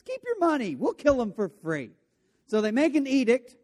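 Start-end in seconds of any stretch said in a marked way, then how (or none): 0.0-1.9 higher, bright and breezy offer; mock-cheerful royal shrug; eager generous king. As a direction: toss it off like it's nothing. Eager king voiced in retelling.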